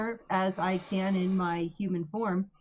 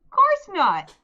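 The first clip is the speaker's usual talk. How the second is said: high, loud, gushing Midwestern voice; cheesy Midwestern accent; 11 semitones higher, 10 dB louder than usual. Acted. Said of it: "cheesy Midwestern accent".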